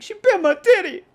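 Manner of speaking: high, loud mock bawl; hammed-up squealing complaint; wailing kid. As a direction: play a hurt child tattling at full wail. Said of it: "I said it just like that".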